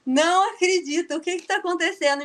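high, loud, half-laughing retold wail; imitating her own despair, fast and high; mock despair. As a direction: replay the disbelief with a laugh through it. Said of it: "eu chorei que nem uma criança".

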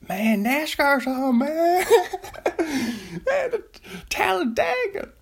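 high, slow mock Southern nascar fan; excited Southern whoop, tickled by himself; Southern accent. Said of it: "Everybody does a Southern accent".

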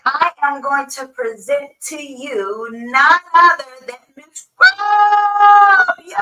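slow, drawn-out sung announcement; announcer. Acted. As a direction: build up the big reveal. Introducing the guest.

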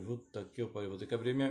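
ordinary talk, nothing marked

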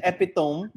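"epitome" said as three syllables, EP-i-tome; mispronunciation. Shown as a demonstration. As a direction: say "epitome" rhyming its ending with "home". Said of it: "You said epitome".

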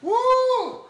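a high, loud whoop of laughter; loud laughing whoop. Real, not acted. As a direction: whoop with delight. Excited outburst after story.

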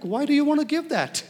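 mock protest, voice jumping way up; retold indignant objection. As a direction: object loudly in a high voice. Retold objection to wife's tip.

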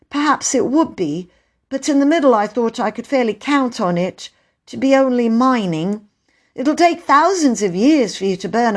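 put-on earnest, high-pitched child's voice; boy explaining himself. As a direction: justify yourself to a grown-up. Peter justifies himself.

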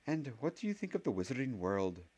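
lightly voiced Scottish schoolmistress congratulating; stern witch professor. A narrator's reading. Professor telling Harry he is a wizard, lightly prim.

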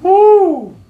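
high loud cheering whoop. Real, not acted. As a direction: let out a big whoop.

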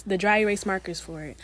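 ordinary talk, nothing marked

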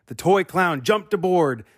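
plain reading voice

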